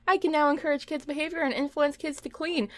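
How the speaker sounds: ordinary delivery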